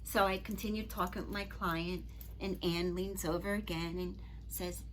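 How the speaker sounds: usual speaking voice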